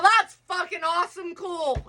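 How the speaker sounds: high sarcastic brush-off. Retold or imitated. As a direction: toss it off, mock cheerful. Sarcastic retort after rejection.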